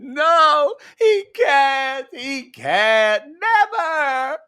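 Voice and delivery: hammed-up crying plea; mock sobbing. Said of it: "She starts just sobbing and going".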